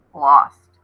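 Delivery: a low, heavy pronouncement; ominous wolf. Acted. Wolf telling the girl her friends' fate, ominously. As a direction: drop the word like a stone.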